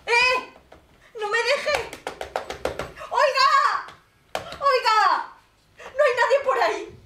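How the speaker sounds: high shouted calls into the empty night; calling out. Acted. Old woman alone at night calling out for someone. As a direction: yell for someone to come back.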